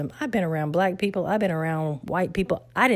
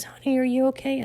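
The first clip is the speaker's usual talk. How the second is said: higher, gentle concerned teacher; kind teacher; 9 semitones higher than usual. Retold or imitated. Teacher comforting a crying student in the hall, higher and gentle.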